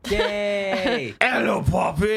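high, loud gleeful cry; high shouted hello, overjoyed; excited greeting. Acted. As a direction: burst out thrilled to see her.

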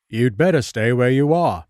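a stern constable telling him off; police officer. Acted. Police officer warns the narrator.

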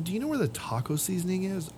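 high, soft, innocent shopper asking; clueless customer. Retold or imitated. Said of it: "the third one, which actually gets me more mad".

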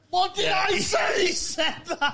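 high, slow triumphant yell; triumphant shout. Real, not acted. Shouting to friends that he was proven right. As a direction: crow that you called it.

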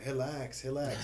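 a light imitation of the instructor's broken-English "Relax"; accented instructor. Jiu-jitsu instructor calming his student, lightly mimicked accent. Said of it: "he had really bad English".

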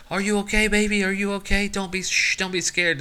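high, loud fretting parent voice; fussy worried parent. Retold or imitated. A tense parent fussing over a scared kid, high and anxious. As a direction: overdo the worry, coddling the kid. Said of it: "you're like".

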